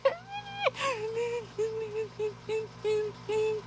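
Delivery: high breathless laughing fit; crying with laughter. Real, not acted. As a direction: laugh till you cry. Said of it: "I'm still crying".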